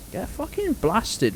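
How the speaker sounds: ordinary talk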